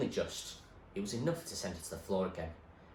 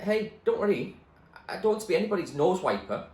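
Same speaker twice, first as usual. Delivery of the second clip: put-on high, quick girl's voice, defiant; scrappy girl brushing off a bully, high and quick; young girl voice; 10 semitones higher, 8 dB louder than usual. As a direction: talk back as a cheeky girl, fast. Zoe's voice, high and loud.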